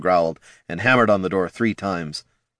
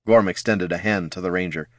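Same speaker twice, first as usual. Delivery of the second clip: ordinary delivery, nothing marked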